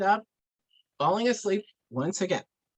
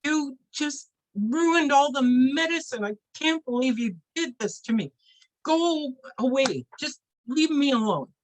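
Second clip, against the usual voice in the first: upset, angry outburst; 9 semitones higher, 4 dB louder than usual. Acted. Medicine man sends his helper away, angry and upset. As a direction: act out a wounded, furious dismissal.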